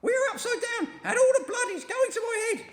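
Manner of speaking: terrified and confused; panicked shriek. A narrator's reading. Missus Twit shrieking that they stand on the ceiling.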